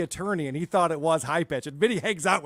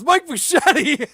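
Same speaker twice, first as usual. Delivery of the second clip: higher and louder, drawn out; loud, slow name call; 9 semitones higher, 8 dB louder, slower than usual. Retold or imitated. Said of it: "Producer Chris says".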